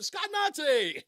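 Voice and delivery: high, loud, drawn-out yell of the nickname; scream. Retold or imitated. Host greeting his favorite caller with a loud, slow yell. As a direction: holler the name across the studio, slow. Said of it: "I would scream".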